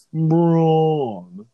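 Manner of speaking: loud, high, drawn-out Braun. Shown as a demonstration. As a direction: savor the name Braun, stretched long. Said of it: "rolls off the tongue".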